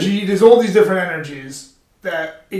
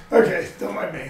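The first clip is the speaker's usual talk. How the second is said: ordinary delivery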